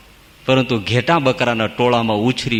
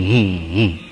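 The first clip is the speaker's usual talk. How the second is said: owl-like hoot; 8 semitones lower, slower than usual. Shown as a demonstration. Preacher likens man's "હું" to an owl's hoot. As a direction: lower owlish hoot of "હું, હું". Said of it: "ઘુવડ સમો ઘૂઘવાટા".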